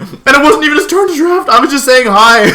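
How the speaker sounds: loud, high-pitched comic outrage; indignant protest. Retold or imitated. Streamer defends himself after retold outburst, mock-indignant. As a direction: protest loudly, breaking into a laugh.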